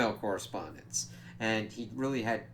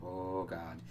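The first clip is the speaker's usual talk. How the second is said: dread-filled murmur; 4 semitones lower, 10 dB quieter, much slower than usual. Retold or imitated. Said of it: "he's like".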